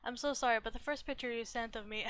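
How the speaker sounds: normal talking voice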